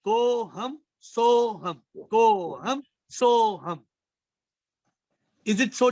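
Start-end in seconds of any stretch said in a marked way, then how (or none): 0.1-1.8 drawn out slowly, each syllable held; slow mantra. Shown as a demonstration. Teacher intones the mantra. 2.1-3.8 drawn out slowly once more, syllables held; slow mantra repeat. Shown as a demonstration.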